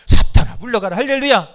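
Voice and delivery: loud, forceful shout; commanding rebuke. Real, not acted. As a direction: shout down the devil. Preacher drives out Satan. (사탄을 쫓는 힘찬 외침)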